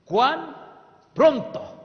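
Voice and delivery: "cuán" and "pronto" each high, loud and set apart; emphatic question. Shown as a demonstration. Preacher drilling the congregation on the key words, emphatic. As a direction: call out each word alone. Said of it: "¿Cuán qué?".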